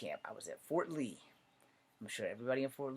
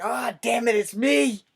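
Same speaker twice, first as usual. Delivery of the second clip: loud, high outburst; mock dismayed realization; 11 semitones higher, 14 dB louder, slower than usual. Recruit discovering they are the culprit, comic loud dismay. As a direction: blurt it out as it dawns on you. Acted sudden realization, cracking up.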